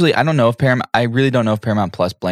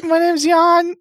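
much higher, slow put-on voice; fake Jan voice; slower than usual. Cohost fakes absent Jan's voice for a joke, high put-on voice. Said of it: "Jan, you don't sound like that".

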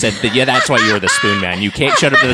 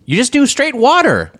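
amazed; 12 semitones higher, slower than usual. Real, not acted. Amazed she only drinks water.

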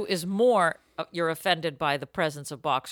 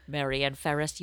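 ordinary delivery

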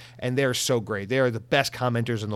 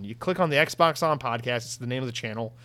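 ordinary talk

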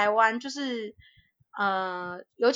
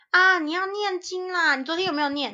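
nagging aunt; 8 semitones higher than usual. Retold or imitated. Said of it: "会说".

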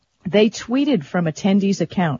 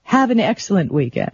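ordinary talk, nothing marked

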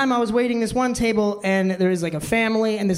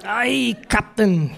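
hammed-up Scottish brogue; badly bluffed Scottish accent; fake Scottish accent; much slower than usual. Waiter keeps bluffing the customer, fake Scottish accent. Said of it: "I had to talk like that".

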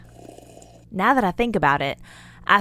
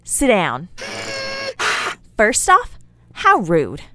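indignant scold; 8 semitones higher, slower than usual. Acted. Scolding someone walking off.

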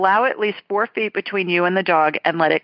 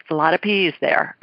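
ordinary talk

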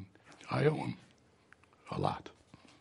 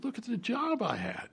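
high, animated and incredulous; excited; 11 semitones higher than usual. Real, not acted. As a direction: toss it off with gusto. Rhetorical, excited recollection.

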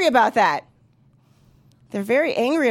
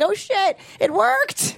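surprised realization; 7 semitones higher, much slower than usual. Retold or imitated. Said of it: "You're like".

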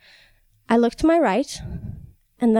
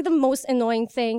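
ordinary delivery

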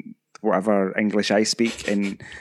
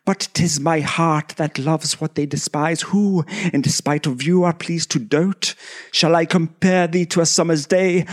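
high, theatrical actor reciting verse; Patrick Stewart; 6 semitones higher than usual. Acted. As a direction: declaim a love sonnet in a deliberately bad English stage voice. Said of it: "the worst Patrick Stewart ever".

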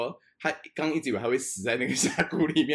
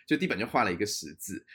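ordinary talk

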